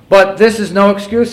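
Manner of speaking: high, loud quoted admission; impassioned reading. Acted. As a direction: declaim it firmly to the room. Reads the confession forcefully.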